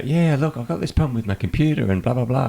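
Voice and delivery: a hint of an annoyed customer; caller voice. Caller voiced in retold call.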